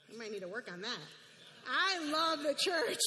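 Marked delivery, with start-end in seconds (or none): 1.7-3.0 emphatic declaration. Real, not acted. Answering her own question loudly.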